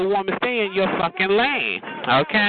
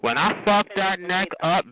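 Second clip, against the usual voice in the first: usual speaking voice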